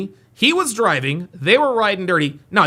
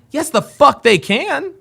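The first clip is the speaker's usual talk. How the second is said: loud, higher emphatic rant; angry; 5 semitones higher, 5 dB louder than usual. Real, not acted. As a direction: slam each word down. Streamer angrily rebuts the clip.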